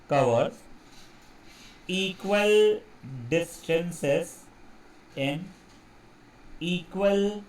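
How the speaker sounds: very slow dictation with long pauses between words; low, measured, one word at a time as if writing. Real, not acted. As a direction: dictate it slowly, pausing after each word. Writing out a definition while saying it.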